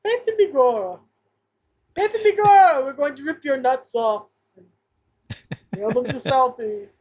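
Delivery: hammed-up Irish gangster accent; mock Irish accent, played for laughs; Irish mobster accent. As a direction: threaten like a stage-Irish mobster.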